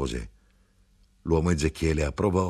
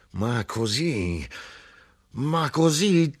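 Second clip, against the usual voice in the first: slow, rising higher to a wail; mounting despair; 8 semitones higher, much slower than usual. A narrator's reading. The grinder despairs over the fine, slow rising wail. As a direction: moan it, breaking upward on the repeat.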